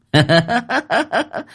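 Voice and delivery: high leering cackle; lecherous giggle, way up high; lewd laugh. Acted. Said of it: "cười dâm tiện".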